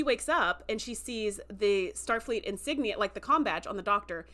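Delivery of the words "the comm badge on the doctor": usual speaking voice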